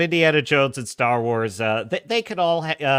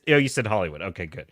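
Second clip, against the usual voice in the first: normal talking voice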